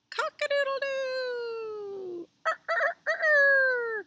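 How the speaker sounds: high, drawn-out cock-a-doodle-doo crow; rooster crow. Pretend. Storyteller to children, here's a rooster saying it, crowing. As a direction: crow like a rooster, stretching the last note. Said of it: "here's a rooster saying cock-a-doodle-doo".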